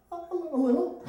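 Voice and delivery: put-on high, stammering child's voice; timid, halting imitation of a boy; scared little boy. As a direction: squeak it out like a frightened kid. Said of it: "are you scared?".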